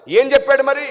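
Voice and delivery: high, loud demanding question; the elder shouts back; shouted challenge. Retold or imitated. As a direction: bark it like a challenge.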